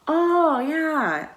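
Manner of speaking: high, loud retold "oh" of realization; sudden recognition. Taxi driver finally recognizes the station, retold delighted recognition. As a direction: imitate someone suddenly getting it.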